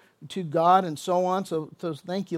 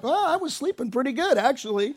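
pitched up high, lightly sheepish excuse; sheepish Jonah; 6 semitones higher than usual. Retold or imitated. Jonah answers sailors after waking, sheepish high excuse. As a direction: shrug it off like a guilty kid. You're like.

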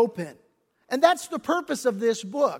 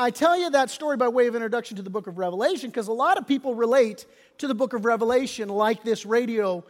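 usual speaking voice

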